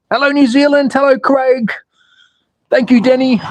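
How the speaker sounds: high, bright-pitched greetings; excited hello to viewers; cheery shout-outs. Real, not acted. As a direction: greet the fans from afar.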